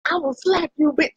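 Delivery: high, mock little-kid threat; toddler threat. Retelling a viral toddler video, high mock threat. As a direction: play the tiny tough kid, high. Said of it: "And he was like".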